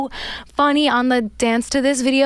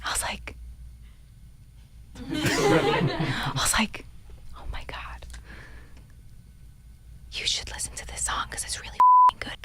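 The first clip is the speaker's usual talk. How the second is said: hushed whisper with a laugh; 9 dB quieter than usual. Real, not acted. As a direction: whisper it as if others might overhear. Whispering a private story.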